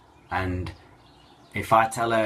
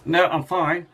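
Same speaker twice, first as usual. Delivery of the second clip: much higher, louder bright denial; strained chirpy no while hiding tears; forced cheer; 11 semitones higher, 6 dB louder, slower than usual. Retold or imitated. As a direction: fake it's-all-fine brightness while holding back tears.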